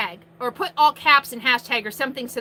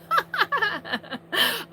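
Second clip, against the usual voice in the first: laugh; 8 semitones higher than usual. Real, not acted. Laughs at the yelling comment.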